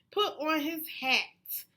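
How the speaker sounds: usual speaking voice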